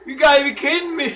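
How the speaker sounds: half-laughing protest; laughing disbelief. Real, not acted. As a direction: laugh through the complaint. Reacting to bad luck, laughing.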